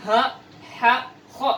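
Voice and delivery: "ha, ha" breathy, then "kho" rasped in the throat; Arabic ha versus kha contrast. Shown as a demonstration. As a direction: set airy ha against throaty kho. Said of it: "Harus beda antara".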